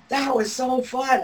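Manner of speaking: high, excited husband's voice; husband delighted. Retold or imitated. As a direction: quote the husband, pleasantly surprised, pitched high. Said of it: "and he's going".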